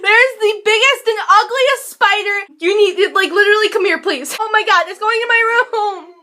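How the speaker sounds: high, loud, frantic pleading; panicked. Real, not acted. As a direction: freak out and beg dad to come now. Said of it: "my genuine reaction to seeing spiders".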